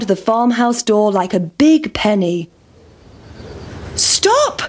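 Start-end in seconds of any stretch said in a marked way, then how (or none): 4.0-4.6 mother's shout. Acted. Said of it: "shouted the mother".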